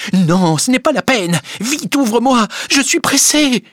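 high, urgent and breathless with haste; urgent hurry. Acted. Emma urging the servant to open quickly, high and urgent. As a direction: press him to open the door now.

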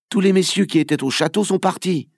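put-on a clever young lookout reporting breathlessly; boy's report.

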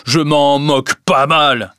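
loud, slow, contemptuous; heavy, angry scorn; harsh dismissal. A narrator's reading. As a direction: throw it in their face. Creditor throwing her out.

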